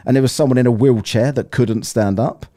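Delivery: usual speaking voice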